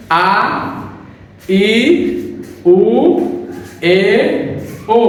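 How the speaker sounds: slow, separate vowels with pauses; careful syllable drill; vowel demo. Shown as a demonstration. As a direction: sound out each vowel alone. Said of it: "প্রথমে".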